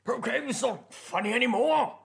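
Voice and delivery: high, in-character protest; indignant calling out. Acted. Player's character pelted by unseen pebbles, calling out indignantly. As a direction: call out in mock indignation to someone hidden. Character calls out to hidden attacker.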